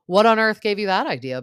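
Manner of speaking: can't believe she said that; incredulous. Real, not acted. Fan answering Lala, loud and incredulous.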